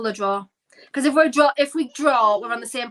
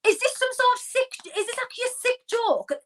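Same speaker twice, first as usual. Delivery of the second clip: high, outraged disbelief; incredulous; 8 semitones higher than usual. Real, not acted. Fan reacting to the substitutions, incredulous. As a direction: protest as if it can't be real.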